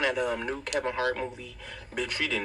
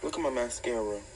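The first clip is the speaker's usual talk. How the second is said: normal talking voice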